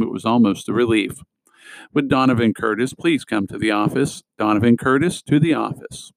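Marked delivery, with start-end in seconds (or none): none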